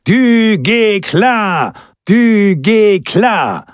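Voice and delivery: high, drawn-out cheering chant; long, high-pitched rallying cries; crowd shouts. A narrator's reading. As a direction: chant the hero's name like a crowd, slow and high. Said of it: "übertönt von den Rufen".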